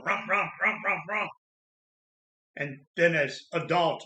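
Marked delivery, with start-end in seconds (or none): 0.1-1.3 put-on playful engine vroom like a child; toy car noise. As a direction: rumble like a kid pushing a toy car. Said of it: "you know what kids do".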